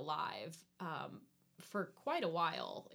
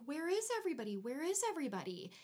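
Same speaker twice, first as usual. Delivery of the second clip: lightly acted bewildered search, pitched up; mock searching call; 7 semitones higher, much faster than usual. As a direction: wonder aloud in a higher, put-on voice. Voices characters searching empty town.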